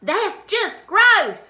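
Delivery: put-on disgusted little girl. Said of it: "the little girl says".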